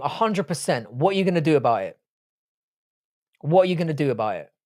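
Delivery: normal talking voice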